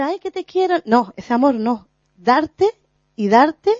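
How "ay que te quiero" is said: high, sugary mock endearment; mock sweet love. Mocking the syrupy kind of love, high and sweet. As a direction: parody a gushy "te quiero".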